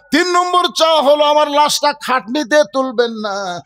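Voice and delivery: high-pitched pulpit plea; impassioned preaching. Acted. Preacher as Fatema pleading with her husband, impassioned preaching. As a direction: call it out as a dying wife's appeal, at full force.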